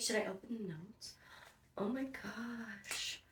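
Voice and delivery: very soft, almost under the breath; hushed awe. Real, not acted. Unboxer finding a sweet note, hushed awe. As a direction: drop right down to a tiny, awed voice.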